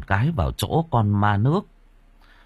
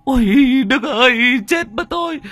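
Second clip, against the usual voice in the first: very high, loud, fast wailing in terror; sobbing screams; 5 dB louder than usual. A narrator's reading. Thắng wailing to Đức as the ghost chases him, very high and loud. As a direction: wail and scream for help. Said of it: "vừa mếu máo, vừa gào thét".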